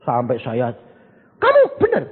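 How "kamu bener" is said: incredulous. Retold or imitated. Pastor retells his shocked question.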